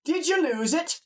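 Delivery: high-pitched, nosy Scottish countryman; Scottish farmer voice pitched well up. Acted. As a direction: call out as a surprised Scottish farmer. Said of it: "like a Scottish person or whatever".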